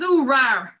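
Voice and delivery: loud mock dog noise; mock dog growl. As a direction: imitate the dog's grumble, loudly. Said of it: "He says".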